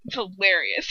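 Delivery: ordinary delivery, nothing marked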